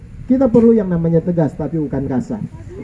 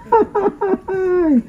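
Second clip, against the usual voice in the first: high-pitched laugh trailing down; 11 semitones higher than usual. Real, not acted. Preacher laughing at own joke with congregation, high laugh.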